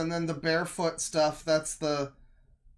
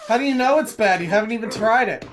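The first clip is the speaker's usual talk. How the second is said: exasperated; 5 semitones higher, 7 dB louder, faster than usual. Real, not acted. Protesting to friend.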